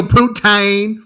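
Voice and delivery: "Poutine" drawn out as "poo-tayng", loud and high; Southern pronunciation. Retold or imitated. Friend mocking a Southerner's accent, "Poutine" twanged as "poo-tayng". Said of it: "That's how it sounds".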